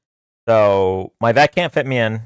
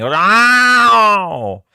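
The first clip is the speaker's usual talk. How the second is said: high loud yowl of a cat in pain, imitated; 9 semitones higher, 5 dB louder than usual. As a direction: copy the cat's pained yowl. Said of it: "he starts yelling".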